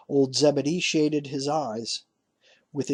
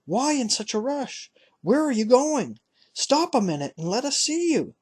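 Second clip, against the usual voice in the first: much higher, pleading call after his son; 11 semitones higher than usual. Acted. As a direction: beg him to stop, held up high. Father calls after hurrying son.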